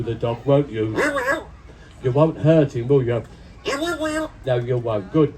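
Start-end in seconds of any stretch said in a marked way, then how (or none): none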